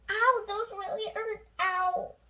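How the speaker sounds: high ow of a hurt villain; pained whine. A narrator's reading. President Penguin complaining the jelly beans hurt, high whine. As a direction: whine it like a sore bully.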